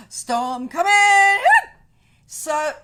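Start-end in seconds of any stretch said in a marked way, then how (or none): 0.1-1.4 mock drama. Mock-dramatic weather warning.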